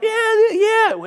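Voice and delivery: high-pitched mock fan yell; imitated yelling. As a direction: whoop like a fan going nuts. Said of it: "She's yelling".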